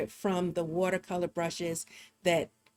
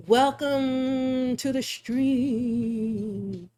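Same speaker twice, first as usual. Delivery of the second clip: very slow spooky welcome; 4 semitones higher, much slower than usual. Pretend. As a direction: drag it out like a haunted host. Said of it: "it sounds spooky or whatever".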